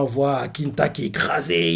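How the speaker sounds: ordinary talk, nothing marked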